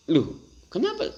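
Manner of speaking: surprised. Retold or imitated. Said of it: "Anda akan".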